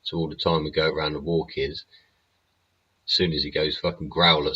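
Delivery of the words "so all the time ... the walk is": normal talking voice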